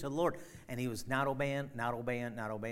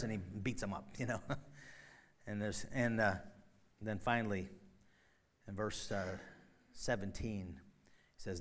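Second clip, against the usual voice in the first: usual speaking voice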